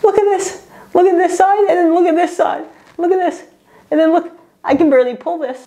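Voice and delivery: high-pitched, squeaky dismay; distressed disbelief. Real, not acted. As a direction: squeak it out, appalled at the result. Showing the shocking result.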